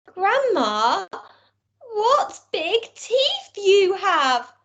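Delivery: put-on high, very slow child's wonder; scared little girl. Girl frightened by teeth.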